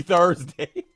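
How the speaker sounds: usual speaking voice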